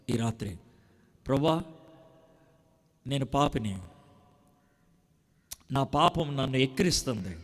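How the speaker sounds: low, hushed prayer for the listener. Acted. Preacher leading the sinner's prayer, low and hushed.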